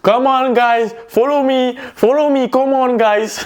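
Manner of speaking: high, slow, hammed-up English tour guide call. Imagining himself as a tour guide with a sign, calling tourists in high hammed-up English. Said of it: "je me vois avec le panneau comme ça".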